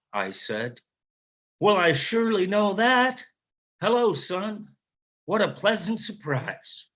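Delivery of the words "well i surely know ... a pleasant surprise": higher, delighted Southern father's voice; warm Southern drawl. Retold or imitated. Father greeting his estranged son on the phone, Southern drawl. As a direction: drawl it out, pleased and surprised, in a Southern accent. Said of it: "slow and slightly Southern drawl".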